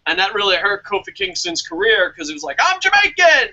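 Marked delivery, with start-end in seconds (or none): 2.6-3.5 higher, louder mock cry of protest; hammed-up indignant shout. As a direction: blurt it out like a wronged wrestler. Retold wrestler's protest.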